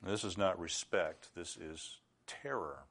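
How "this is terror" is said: much softer and lower, slow; hushed gravity. Real, not acted. Preacher naming Isaiah's fear to the congregation, hushed and grave. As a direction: drop right down for the weighty word. Preacher drops voice on key word.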